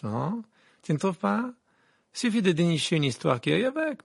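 affected, scheming reporter's chatter; self-satisfied gossip journalist. Acted. Rita plots a scandal with her photographer, self-satisfied. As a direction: play a nosy columnist plotting a story.

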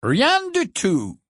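haughty portrait. Acted. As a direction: let it hang, superior. Phineas dismisses Harry.

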